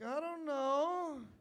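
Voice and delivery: slow, loud, drawn-out mock; teasing shrug. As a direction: play dumb, stretching it. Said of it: "He's like".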